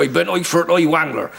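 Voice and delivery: rustic Somerset accent, lightly voiced. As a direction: repeat it as a West Country yokel. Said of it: "when I was in Somerset".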